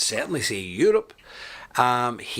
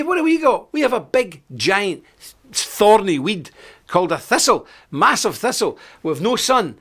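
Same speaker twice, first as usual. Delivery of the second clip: playful rant; 9 semitones higher, 6 dB louder than usual. Real, not acted. Playful rant at viewer Andy.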